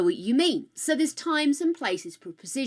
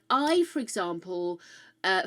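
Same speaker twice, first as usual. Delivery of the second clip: normal talking voice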